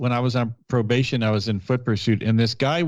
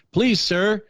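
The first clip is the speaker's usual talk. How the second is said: mock polite plea; 7 semitones higher, slower than usual. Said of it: "you're not gonna go".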